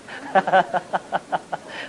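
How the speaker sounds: short run of rhythmic chuckles. Real, not acted. Monk telling listeners how a compliment fell flat, chuckle. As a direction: laugh lightly at your own story. Laughs at own deflating anecdote.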